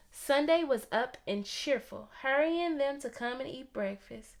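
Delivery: ordinary delivery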